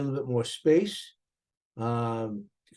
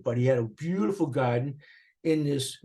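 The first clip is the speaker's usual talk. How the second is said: ordinary talk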